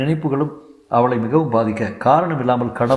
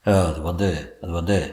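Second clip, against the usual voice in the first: lower, hesitant faltering wife; frightened wife stammering; flustered stammer; 5 semitones lower than usual. Acted. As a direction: stumble over அது வந்து, caught out. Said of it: "மிரள மிரள விழித்து".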